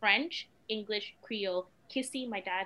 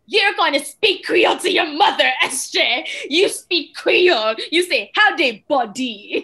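imitating husband; 7 semitones higher, 17 dB louder than usual. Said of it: "being the British guy that is".

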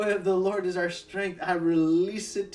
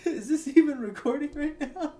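laughing; 10 semitones higher than usual. Real, not acted. Giggling mid-sermon, checks recording.

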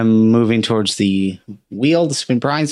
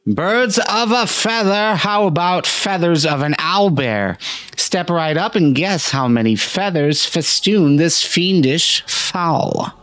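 carnival barker; 6 semitones higher than usual. Acted. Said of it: "yells out".

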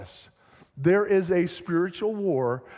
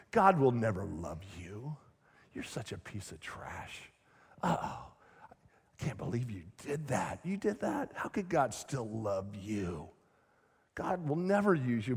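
low, soft, sneering accuser; shaming demon voice; 6 semitones lower, 8 dB quieter than usual. Acted. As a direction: whisper shame into their heart. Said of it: "the same voices that tempt you ... pours out shame".

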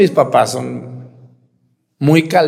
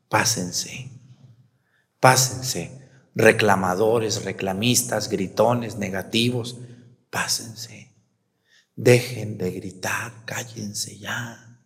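low, softer, then whispered; hushed dismissal; 6 semitones lower, 6 dB quieter, slower than usual. Pretend. Preacher voicing the parted sea shooing the complainers, whispering. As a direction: shoo them through in a hush.

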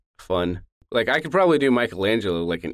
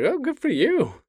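much higher nerd voice; nerdy voice. Retold or imitated. Reacting to Armstrong's news in his nerdy voice. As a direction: chirp it like the nerdy friend.

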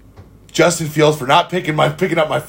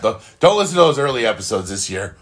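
ordinary talk, nothing marked

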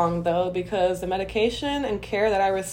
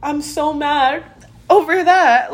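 retold complaint to friend; 7 semitones higher, 4 dB louder than usual. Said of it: "I said".

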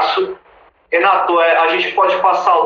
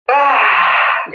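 excited shout; 9 semitones higher than usual. Real, not acted. Excited reaction.

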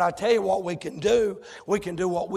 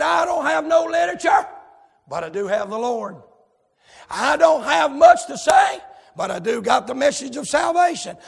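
acted loud testimony; 6 semitones higher, 5 dB louder than usual.

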